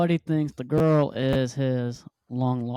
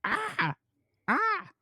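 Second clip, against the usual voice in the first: mock screams; 5 dB quieter than usual.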